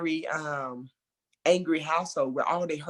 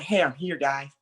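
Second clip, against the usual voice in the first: ordinary talk, nothing marked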